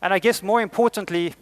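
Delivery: ordinary talk, nothing marked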